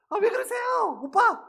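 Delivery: imitated high-pitched yelp; mock squealing protest. Women teachers being thrown in the river, mock squeal. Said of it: "막 소리 지릅니다".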